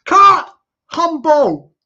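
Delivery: very loud cut; director yelling. Acted. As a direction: halt the take with a loud shout. Director shouts cut again.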